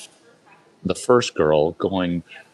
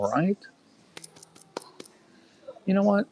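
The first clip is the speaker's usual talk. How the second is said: ordinary talk, nothing marked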